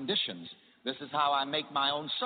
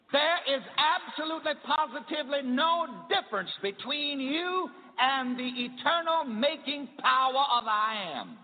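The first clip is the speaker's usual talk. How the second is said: high, ringing preacher's build; preaching climax; 10 semitones higher, 3 dB louder than usual. Acted. Preacher proclaiming to his church, high and ringing. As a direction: lift it up to the rafters.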